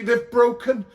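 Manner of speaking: loud, high and panicky; alarmed Mary. A narrator's reading. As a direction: blurt it in alarm. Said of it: "she started screaming".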